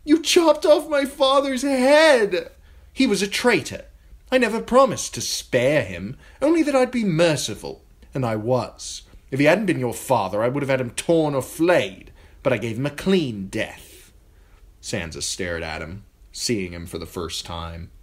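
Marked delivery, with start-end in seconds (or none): 0.0-2.5 high, loud wail; grieving girl wailing at the king. A narrator's reading. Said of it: "Sansa wailed". 2.9-14.1 high, louder, defiant justification; spoiled king to grieving betrothed; self-righteous boy king. A narrator's reading. Joffrey justifies the execution.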